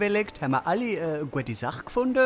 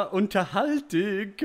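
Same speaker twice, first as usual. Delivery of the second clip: usual speaking voice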